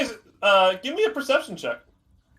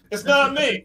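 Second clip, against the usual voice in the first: copied voice; 9 semitones higher than usual. Acted. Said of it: "they just hear Rosie's voice say".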